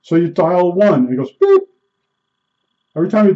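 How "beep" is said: high squeaky beep from the mouth; short sharp high beep sound; imitated beep. Shown as a demonstration. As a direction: mimic the dial tone beep. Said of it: "I can't even do it with my mouth".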